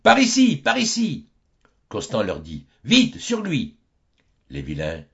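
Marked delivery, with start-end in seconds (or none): 0.0-1.3 a high yell of excited pursuers; shouting. Acted. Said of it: "Tous s'écrient". 2.8-3.8 urgent shout. Acted. Costan urges the villagers on.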